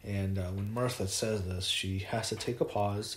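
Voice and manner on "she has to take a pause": ordinary talk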